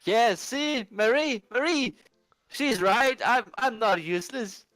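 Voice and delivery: high, loud protest; indignant drunk character. Acted. Drunk man appeals to Marie about being useless, indignant. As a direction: plead your case in a high, insistent voice.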